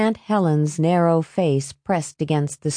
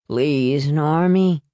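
slurred, drawn-out plea; whining boozy appeal; begging; much slower than usual. A narrator's reading. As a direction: implore him like a needy drunk. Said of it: "his father begged".

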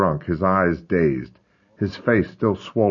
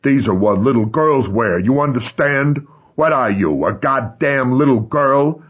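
loud sneering contempt; mocking rage; 6 semitones higher, 5 dB louder than usual. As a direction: taunt him with loud scorn. Ray humiliating boy.

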